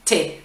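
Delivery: "t'es" as one syllable, "té"; no "u" at all in "t'es"; vowel dropped. Shown as a demonstration. Teacher showing contracted "tu es", one-syllable pronunciation. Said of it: "complètement enlever le".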